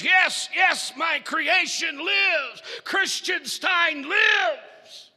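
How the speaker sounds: high, loud, slow triumph; ecstatic shout. Acted. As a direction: stretch out each word in victory. Doctor's triumphant cry.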